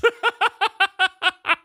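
high-pitched burst of giggling; high giggle. Real, not acted. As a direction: crack up laughing. Laughing at cat pirate.

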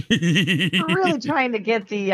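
slow, laughing through the words; giggling as you speak. Real, not acted. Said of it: "pirate speak in here".

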